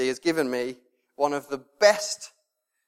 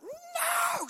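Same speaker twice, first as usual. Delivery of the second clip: retold scream; 8 dB quieter than usual. Dad mimics his daughter screaming at him, high mock scream. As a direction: imitate a child screaming in protest, held back in volume. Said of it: "Screams at me".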